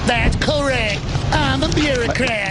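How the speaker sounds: high, slow mock East Texas drawl; high mocking East Texas twang; East Texas accent. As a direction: play the smug Texan bureaucrat. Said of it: "I can do this accent perfectly".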